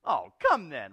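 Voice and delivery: loud, breezy comeback; gaily. Acted. Nephew answers his grumpy uncle, gaily. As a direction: toss it back good-humouredly. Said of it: "returned the nephew gaily".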